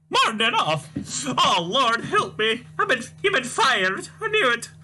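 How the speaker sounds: put-on high, wailing, scolding old mother voice; shrill old Scottish mum. Mother wails at son Tavish, high despairing scold. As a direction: play the despairing mother crying to the Lord.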